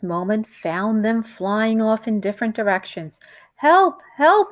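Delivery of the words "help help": high, loud panicked cries; wife yelling for help, stuck window; cry for help. Acted. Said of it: "cried out".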